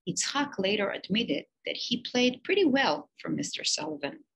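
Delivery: ordinary delivery, nothing marked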